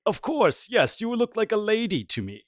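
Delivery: high, loud, eager reassurance; butter her up in a high, eager voice; fawning valet. Acted. Valet flatters his anxious mistress.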